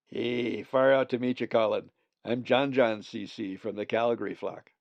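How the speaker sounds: a mellow Calgary raven saying far out; laid-back hippie. A narrator's reading.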